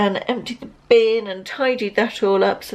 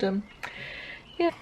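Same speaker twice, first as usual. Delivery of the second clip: normal talking voice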